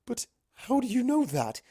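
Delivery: high, puzzled princess voice; high, startled question; baffled Luna. Acted. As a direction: ask it in disbelief.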